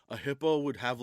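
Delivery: usual speaking voice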